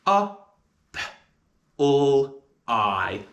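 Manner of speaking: a, p, l, I said one by one; sounding out apply. Shown as a demonstration. Teacher sounding out apply. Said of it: "And the sounds of apply are".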